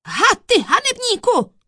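high, loud roar of outrage; thunderous indignant outburst. A narrator's reading. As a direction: roar at the villain. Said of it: "burácel soudce".

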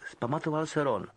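usual speaking voice

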